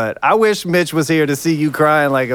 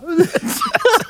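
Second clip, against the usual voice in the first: laughing. Acted. Amused at the Hawaiian heart.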